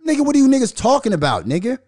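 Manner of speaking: high, loud, fast disbelief; exasperated outburst. Real, not acted. Ranting at rappers to viewers, loud high disbelief. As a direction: yell it in exasperation.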